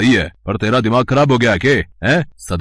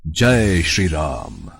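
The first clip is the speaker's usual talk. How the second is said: lower, very slow, solemn chant of devotion; divine Hanuman; 6 semitones lower, much slower than usual. Acted. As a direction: intone it like a god revealing himself. Hanuman in true divine form.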